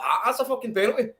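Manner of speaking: high-pitched mock indignation; indignant fan. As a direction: imitate a fan crying for a penalty. Acting out a fan's reaction.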